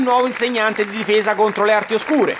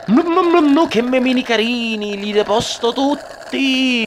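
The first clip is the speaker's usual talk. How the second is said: mock gushing; 4 semitones higher, slower than usual. Gushing over cute memes, put-on voice.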